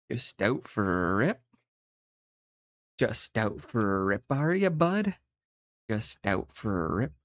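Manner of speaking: slow, drawling Canadian hoser question; Canadian accent. Acted. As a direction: draw out each question in broad Canadian, lifting the end. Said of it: "we can all turn the accent on".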